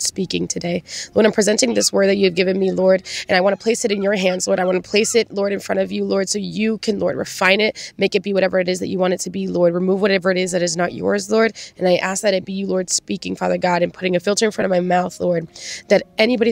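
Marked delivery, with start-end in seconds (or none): none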